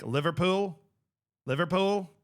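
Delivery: Liverpool with each O sounded; Scouse pronunciation. Shown as a demonstration. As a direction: give Liverpool both its Os, Scouse style. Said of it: "they pronounce each O".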